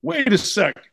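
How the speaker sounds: high-pitched run of whoas, then wait; retold protest. Players objecting to being told to stay away, retold protest. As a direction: halt them with a high mock protest.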